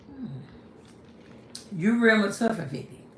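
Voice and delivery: normal talking voice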